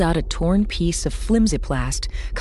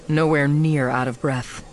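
ordinary delivery, nothing marked

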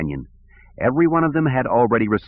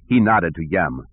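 ordinary delivery

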